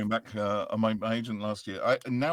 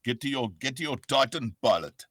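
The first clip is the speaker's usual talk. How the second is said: loud, commanding South African game character; South African accent; 3 semitones higher, 6 dB louder than usual. Shown as a demonstration. Game character ordering a pilot about, South African accent. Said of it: "big South African character on Titanfall".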